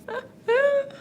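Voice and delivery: high laughing whimper through real tears; half laugh half cry at her own tears; laughing sob. Real, not acted. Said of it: "I'm full on crying".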